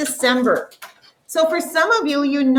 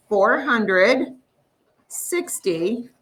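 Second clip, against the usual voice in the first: number broken up slowly, with pauses; 3 semitones lower, slower than usual. Shown as a demonstration. Teacher showing expanded form of a number, very slowly. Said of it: "I just simply said this number very slowly".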